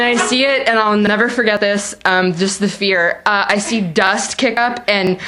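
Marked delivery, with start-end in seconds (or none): none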